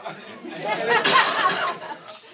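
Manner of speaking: loud high burst of laughter. Real, not acted. After the crew's lame reply, loud laughter. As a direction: laugh hard at the lame answer. Laughs at voter's non-answer.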